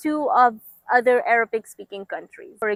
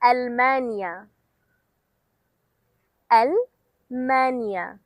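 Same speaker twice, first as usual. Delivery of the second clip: slowly; much slower than usual. Shown as a demonstration. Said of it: "One more time".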